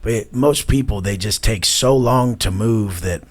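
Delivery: ordinary delivery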